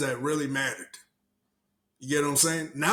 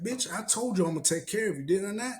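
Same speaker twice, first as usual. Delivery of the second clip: higher, demanding pimp voice; mock pimp; 6 semitones higher than usual. Obama as a pimp ordering Kamala over, higher voice. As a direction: lean into the threat. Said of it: "He's like".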